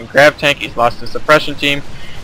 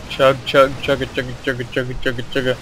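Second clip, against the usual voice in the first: rhythmic chug-chug, dropping softer; train chugging imitation; 5 dB quieter than usual. Game caster bringing the pain train, soft rhythmic train noises. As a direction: play at being a train, quietly chugging along.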